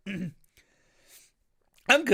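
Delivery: throat clearing after choking; recovering from choking. Real, not acted. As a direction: still catching your breath after coughing. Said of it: "it went down the wrong way".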